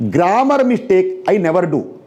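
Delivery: ordinary talk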